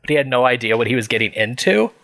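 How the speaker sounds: ordinary talk, nothing marked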